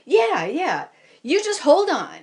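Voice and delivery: cheerful pilot urging her on; breezy instructor. Retold or imitated. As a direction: toss it off like it's no big deal. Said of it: "And he says".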